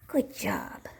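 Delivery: whispered praise; soft whisper to the dog. Real, not acted.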